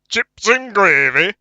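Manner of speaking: put-on northern accent. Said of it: "in not a northern accent".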